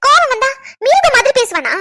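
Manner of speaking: high, indignant challenge; indignant retort. Acted. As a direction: throw the excuse back as an outraged question. Angry retort to his excuse.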